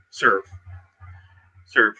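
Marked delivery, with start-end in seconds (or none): none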